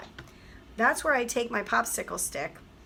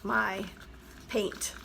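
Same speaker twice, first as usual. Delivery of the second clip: ordinary talk, nothing marked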